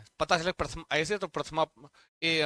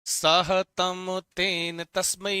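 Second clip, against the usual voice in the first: सह तम तेन तस्मै drawn out syllable by syllable; slow recitation; much slower than usual. Shown as a demonstration. Teacher drilling students, reciting सह तम तेन तस्मै very slowly. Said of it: "चार चार का पेयर बनाएंगे".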